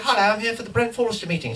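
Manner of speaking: brisk introduction in a fake English accent. Disguised writer greets the comedy agent, English accent. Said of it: "I'm speaking in an English accent".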